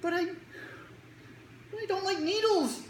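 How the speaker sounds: put-on high, slow whiny kid voice; scared kid. Kid telling the doctor he fears needles, high slow whine. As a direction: drag it out like a frightened child.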